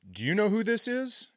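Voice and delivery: high-pitched questioning concertgoer; guy sitting next to him. Retold or imitated. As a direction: imitate a guy asking his seatmate. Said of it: "looks at me and he goes".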